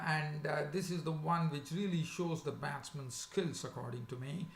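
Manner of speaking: ordinary talk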